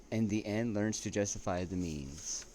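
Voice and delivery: slow, weighty reading of a quotation; reading a quote aloud. Acted. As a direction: read it out like a solemn citation. Reading a quote by Lord Acton.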